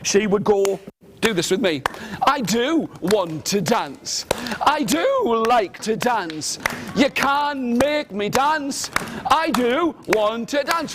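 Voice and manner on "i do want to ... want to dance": drawn-out, swooping declaration; slow, emphatic storybook voice; insistent refrain. Acted. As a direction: stretch each word out with insistence. Acting out Lily's insistent refrain.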